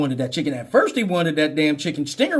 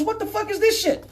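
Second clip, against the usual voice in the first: high-pitched disbelief without raised volume; incredulous officer; 14 semitones higher than usual. Acted. Cop finding a whip in the bag, incredulous. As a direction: squeak it out in baffled alarm. Officer reacting to bag's contents.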